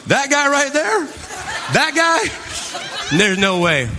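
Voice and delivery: high incredulous mock laugh; mocking disbelief. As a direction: point and scoff through laughter. Mocking people on stage.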